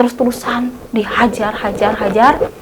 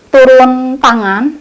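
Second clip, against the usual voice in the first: ordinary talk, nothing marked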